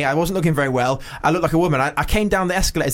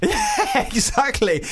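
laughing through yeah, exactly; real laughter over the words; 10 semitones higher, much slower than usual. Real, not acted. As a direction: talk through a high laugh. Laughing at the John Connor joke.

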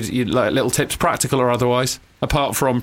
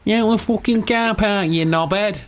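high, slow mock Mancunian; hammed-up Manchester accent; 8 semitones higher, slower than usual. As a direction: drag it out as a stroppy Mancunian. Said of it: "That sounded like Manchester".

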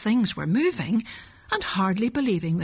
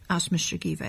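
ordinary delivery

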